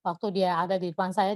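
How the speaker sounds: ordinary delivery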